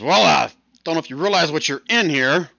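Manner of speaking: a touch of scorn for clueless newcomers; scoffing. Acted. Human lady to the party, mildly scoffing. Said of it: "She kinda scoffs at you".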